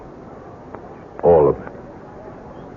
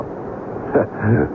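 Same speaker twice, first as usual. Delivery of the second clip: dry mocking laugh; 3 semitones higher, 5 dB quieter than usual. Gangster laughs at face job.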